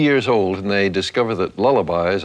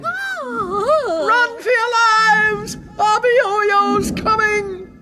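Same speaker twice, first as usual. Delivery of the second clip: much higher, drawn-out panicked yelling; panicked townsfolk; much slower than usual. Acted. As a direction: wail a warning to the whole town. Townsfolk fleeing the giant.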